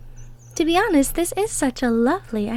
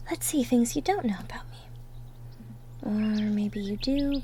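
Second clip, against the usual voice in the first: whisper; 3 semitones lower, 7 dB quieter than usual. Acted. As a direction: think aloud in a breathy hush. Musing about herself.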